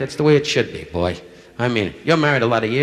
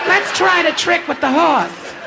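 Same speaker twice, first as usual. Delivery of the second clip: much higher, louder and slow; mock bride's voice; 5 dB louder, slower than usual. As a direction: coo it out as the eager bride. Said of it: "[name]'s explaining it to Larry".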